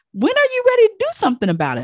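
rally her to start now; urgent encouragement. Retold or imitated. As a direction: esthetician urging a client, excited and fast. Urging the client to act.

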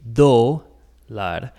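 dólar drawn out with the O stretched and stressed, DO-lar; exaggerated stressed O. Shown as a demonstration. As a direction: lean hard on the O in dólar. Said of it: "we put emphasis on that O".

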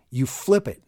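ordinary talk, nothing marked

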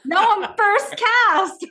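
high, loud and slow, laughing with pride; proud laugh. Real, not acted. Dancer telling podcast hosts she's first cast, proud laugh.

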